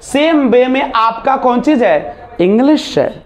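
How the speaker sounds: ordinary delivery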